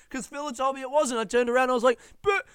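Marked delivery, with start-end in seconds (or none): 2.2-2.5 very high, loud, slow crying shout; whiny heartbroken protest. Retold or imitated. To the bar staff about the closed kitchen, bawling protest. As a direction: bawl it like a child denied a treat. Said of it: "that level of devastated".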